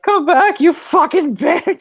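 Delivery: imitating a captive's furious shriek; furious screaming. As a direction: scream the insult up after her as she leaves. Captive's scream retold at Clarice.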